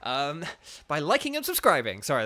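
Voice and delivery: ordinary talk, nothing marked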